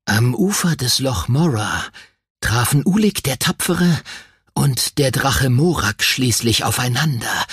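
ordinary delivery

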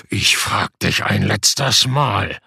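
low, slow, menacing giant voice; threatening giant. A narrator's reading. Giant threatening Sebastian one last time, low and menacing. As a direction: loom over him and threaten slowly. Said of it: "drohte der Riese".

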